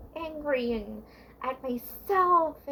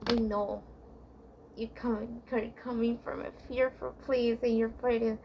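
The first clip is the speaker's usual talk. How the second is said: ordinary talk, nothing marked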